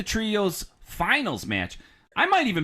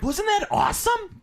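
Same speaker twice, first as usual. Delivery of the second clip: excited fan. Retold or imitated. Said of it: "be like".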